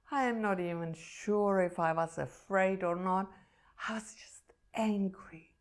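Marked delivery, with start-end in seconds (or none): 3.9-5.5 a hushed, breathy confession; barely voiced admission of anger; whisper. Real, not acted. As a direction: whisper it to yourself. Confessing her anger at surgery.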